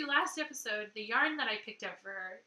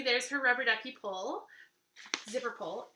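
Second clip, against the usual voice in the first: usual speaking voice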